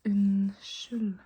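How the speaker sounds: "Unnskyld" slowly sounded out, ending on L; silent D. Shown as a demonstration. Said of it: "The D is not pronounced".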